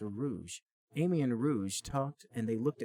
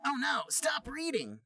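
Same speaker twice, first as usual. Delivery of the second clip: much higher, urgent plea; Sonic panicking. Acted. Sonic begging the teacher to stop, higher panic. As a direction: beg her to stop. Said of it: "said Sonic".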